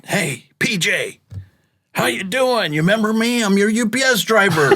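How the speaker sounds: high, eager calling out to a player; excited fan greeting. Retold or imitated. Fan calls out to P J Tucker courtside, high and eager. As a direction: hail him like an old buddy.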